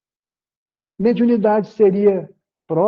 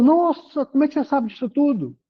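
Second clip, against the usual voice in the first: higher-pitched wondering question; acted astonishment; 6 semitones higher than usual. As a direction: marvel at it, pitched up. Acted astonished reply of helped person. (espanto encenado, em tom agudo)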